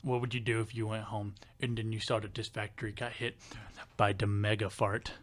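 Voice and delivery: imitated low country voice; deep country coworker. Imitating the coworker posing a factory scenario, deep country voice. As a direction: ask it earnestly, deep and country. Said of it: "he had a real deep down low voice".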